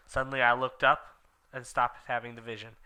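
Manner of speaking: ordinary delivery, nothing marked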